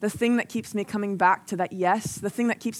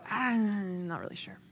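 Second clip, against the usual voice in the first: noncommittal; 8 dB quieter than usual. Acted. Mimicking a noncommittal response to warnings.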